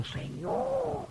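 very high, soft, drawn-out protest; plaintive mock wail. Pastor protesting to God about his ministry, plaintive mock wail. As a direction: let it trail away in a thin whine.

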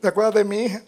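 high, lightly tearful retelling; imitated tearful speech. Preacher imitating a mother asking about her daughter, mildly tearful. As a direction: voice a distressed mother, pitched high. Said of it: "Y llorando".

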